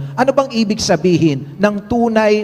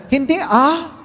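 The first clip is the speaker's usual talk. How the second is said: mother's voice; 8 semitones higher, slower than usual. Acted. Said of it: "Sumagot ang nanay".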